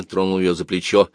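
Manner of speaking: usual speaking voice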